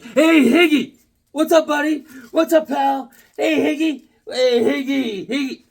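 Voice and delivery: high, loud, pestering greeting; mock an office pal hailing you again and over; imitating over-chummy coworker. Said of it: "He's like".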